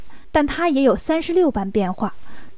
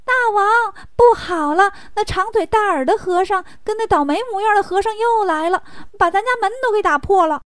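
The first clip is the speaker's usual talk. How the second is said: high-pitched, urgent underling voice; panicky little demon; 7 semitones higher than usual. Acted. Little demon rushes to report to the chief, panicky. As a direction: blurt out the bad news in alarm. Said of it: "赶紧跑去报告".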